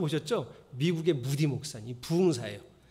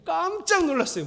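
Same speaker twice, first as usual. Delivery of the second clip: shocked; 11 semitones higher, 5 dB louder than usual. Retold or imitated. Moody shocked at the door.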